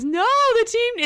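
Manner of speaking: high, loud hammed-up wail of despair; mock anguished cry. Teasing an imagined tearful scene, hammed-up loud wail. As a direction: overplay the tearful outburst. Mock crying breakdown in car.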